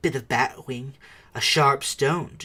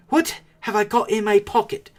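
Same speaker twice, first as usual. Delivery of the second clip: high, loud musing question; Bilbo asking aloud; 9 semitones higher, 8 dB louder than usual. A narrator's reading. Bilbo wondering aloud to himself, loud. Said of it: "he said aloud".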